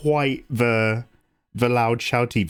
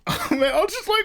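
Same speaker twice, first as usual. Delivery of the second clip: high, choked-up laugh-crying; tearful. Real, not acted. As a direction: break into teary laughter mid-sentence. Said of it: "I'm literally gonna cry".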